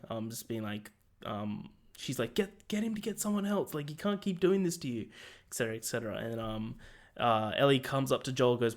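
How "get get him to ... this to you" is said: lightly voiced protest, pitched up high; Maria. Maria fighting with Tommy, higher-pitched mock protest. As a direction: imitate a wife arguing, higher voice without shouting. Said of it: "Maria and Tommy are, like, fighting".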